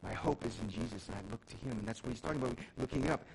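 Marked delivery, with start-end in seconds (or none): none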